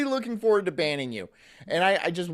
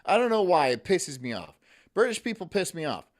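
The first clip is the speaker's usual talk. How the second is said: ordinary delivery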